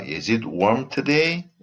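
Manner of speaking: "today" swept upward at the end; rising question intonation. Shown as a demonstration. Teacher models a yes-no question, rising intonation on "today". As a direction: let the pitch climb through "today". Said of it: "the intonation goes up".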